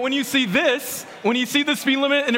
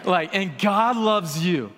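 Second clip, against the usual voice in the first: slow, lower, pressing the word love home; insistent brotherly love; 5 semitones lower, slower than usual. Retold or imitated. Anthony repeating himself to the speaker: slow, lower, earnest insistence. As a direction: lean in and insist, slowly and lower. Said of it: "Anthony likes to repeat himself".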